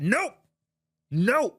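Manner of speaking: loud, high "Nope! No!" flung out as a flat refusal; mock shouted refusal. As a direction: bark the "No!" like a governor brushing you off. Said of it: "let me do my Tim Walz voice".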